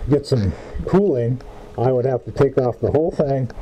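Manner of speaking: muffled behind respirator; speaking inside sealed mask; through a face mask. Real, not acted. Seen 1: Half-face respirator covers mouth and nose throughout; lowered by 9.1s.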